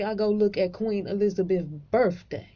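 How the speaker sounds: ordinary talk, nothing marked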